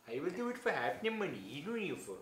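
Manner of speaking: lilting, badly faked Indian accent; awful Indian accent. Offering to take the actor's place, in a mock Indian accent. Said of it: "awful Indian accent".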